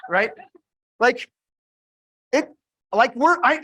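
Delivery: ordinary talk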